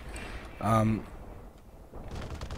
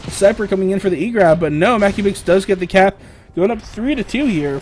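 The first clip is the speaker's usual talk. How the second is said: loud, higher hype call; excited play-by-play; 6 semitones higher, 7 dB louder than usual. Real, not acted. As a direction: shout the cap as it lands. Caster calls the go-ahead cap.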